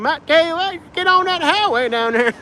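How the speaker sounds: very high backwoods Alabama twang; Alabama accent. Acted. Local giving a lost driver directions, high hillbilly accent. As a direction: put on a thick Appalachian hillbilly accent. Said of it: "that's a real accent".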